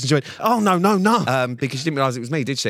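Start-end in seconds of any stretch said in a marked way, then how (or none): 0.4-1.2 high, loud mock-panicked protest; imitated panic. Imitating a woman panicking at the helmeted visitor, high mock alarm. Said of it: "she panicked because she thought it might be someone else".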